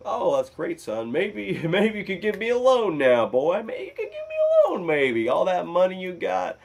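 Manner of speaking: high, loud mocking dad voice; dad's reaction. Dad reacting to son's big earnings, hammed-up reaction. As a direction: gush it loudly, a hammed-up father. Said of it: "my dad's reaction to it was".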